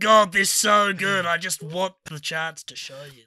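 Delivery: hammed-up piss-take with stretched words; mocking co-host voice. Co-host wanting a chance to show off, mocking voice. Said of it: "the full story of that voice".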